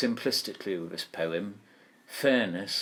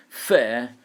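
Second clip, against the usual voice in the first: "fair" said alone, louder and held out; stressed word; 3 semitones higher, 7 dB louder than usual. Shown as a demonstration. Teacher isolating the stressed word for the class, emphatic. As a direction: give "fair" a firm, loud accent. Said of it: "the first syllable stressed".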